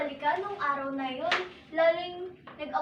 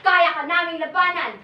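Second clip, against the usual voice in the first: defiant shout; 7 dB louder than usual. Acted. Family defies the virus.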